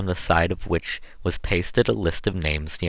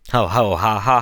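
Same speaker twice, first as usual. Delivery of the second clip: diabolical laugh; 3 semitones higher, 5 dB louder than usual. Acted. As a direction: cackle it out like a pair of cruel brutes. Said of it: "laughed both the sooty wretches".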